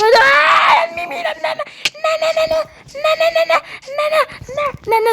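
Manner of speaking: shrill panicked no's as he gets hit; panicked high cries. Real, not acted. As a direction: cry out in panic, pitch jumping way up. Character attacks him in game.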